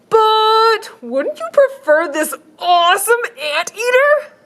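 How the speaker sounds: high, slow salesman pitch; shelter man voice. Acted. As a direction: sell it like an eager shopkeeper. Shelter man voiced in picture book.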